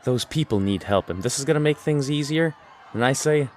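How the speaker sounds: ordinary talk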